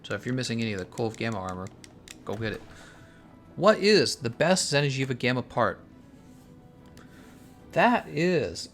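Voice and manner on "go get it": ordinary delivery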